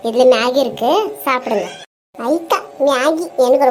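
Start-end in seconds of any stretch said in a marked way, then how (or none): none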